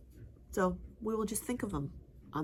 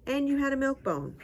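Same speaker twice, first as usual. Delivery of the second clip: mock scolding; 8 semitones higher, 4 dB louder than usual. Real, not acted. Scolding the dog.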